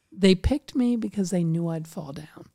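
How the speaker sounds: quiet, matter-of-fact insistence; subdued restatement. Real, not acted. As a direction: bring it right down after the outburst. Same words, brought down after outburst.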